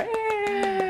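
high cheer for the guest. Real, not acted. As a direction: cheer her on. Cheering for the co-host. (grito de comemoração)

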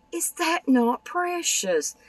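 very high, slow, gushing squeal; Isn't that precious squealed very high and slow; delighted squeal. Real, not acted. Gushing over fold card.

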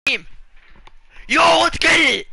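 high, drawn-out, full-blast yell; huge victory scream; real celebratory shouting. Real, not acted. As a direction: roar it out in triumph. Celebrates the rare pull.

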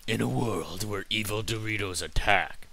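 movie-trailer narrator voice; horror trailer voice. Pretend. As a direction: announce it like a dramatic trailer. Mock movie-trailer line about Doritos.

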